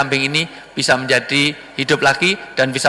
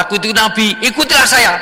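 boastful liar; 8 semitones higher, 3 dB louder than usual. Acted. Liar claims to be prophet.

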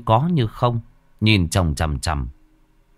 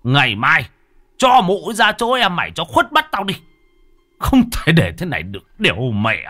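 exasperated father; 5 semitones higher, 4 dB louder than usual. Acted.

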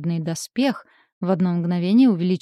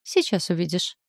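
mysterious. A narrator's reading. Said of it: "загадочно улыбнулся напарник".

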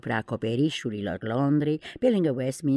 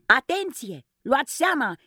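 much higher, louder alarm; warning shout; 11 semitones higher, 3 dB louder than usual. Acted. Peter Pan warning the children of the cannonball. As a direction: get them to duck. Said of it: "strigă Peter Pan".

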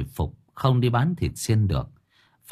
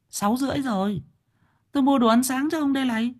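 very high put-on woman's voice; matter-of-fact wife; faster than usual. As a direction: answer briskly as the wife, pitched high. (giọng bà vợ cao, đáp nhanh)

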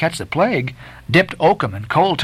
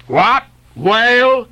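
puzzled reply; 9 semitones higher, 3 dB louder, slower than usual. A narrator's reading.

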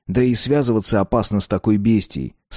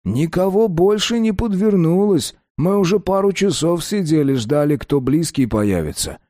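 calm thug voice; 8 semitones higher than usual. A narrator's reading. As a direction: soothe him lazily like a crook. Mamud voiced as character.

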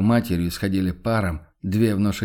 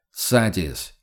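plain reading voice

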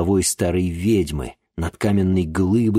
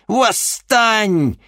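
high, loud, drawn-out Восстань with a falling end; Восстань cried out high and long; shouted command; 5 dB louder than usual. Acted. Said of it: "крикнула ведьма".

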